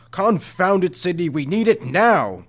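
impatient yell for the flashlight; exasperated. A narrator's reading. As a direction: snap at the fumbling rat. Impatient guardian yelling at rat.

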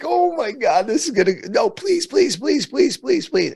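high-pitched retold begging; frantic pleading. Child begging mom not to go in, frantic pleading. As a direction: re-enact a child's desperate string of pleas. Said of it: "I'm pleading with her".

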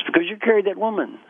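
high-pitched indignant retort; lightly acted angry monk; mock angry. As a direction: accuse indignantly without raising volume. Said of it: "The angry monk says".

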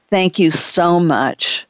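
usual speaking voice

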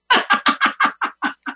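high airy laugh; breathy laugh. Shown as a demonstration. Yogi laughing after feel good, airy laugh.

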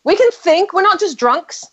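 high-pitched mock-proud protest; acted proud vindication. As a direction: prove a point, playfully triumphant. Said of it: "I'm like".

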